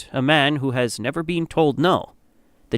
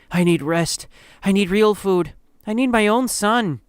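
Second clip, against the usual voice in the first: lightly hoarse plea, climbing higher; 5 semitones higher than usual. A narrator's reading. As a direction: plead with a touch of hoarseness. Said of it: "You with a hoarse voice say".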